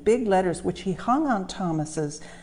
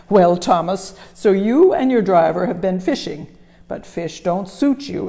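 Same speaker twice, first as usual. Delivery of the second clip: louder, booming boss; a big jolly boss scolding Thomas; fat controller voice; 8 dB louder than usual. Acted. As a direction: boom it like a pompous railway boss.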